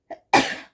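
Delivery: sudden loud cough; a quick hard cough into the mic; sharp cough. Real, not acted. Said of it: "I'm gonna sneeze".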